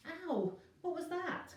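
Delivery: high startled yelp; startled cry of pain. A narrator's reading. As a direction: yelp after hitting the floor. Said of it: "cried Virgo from the floor".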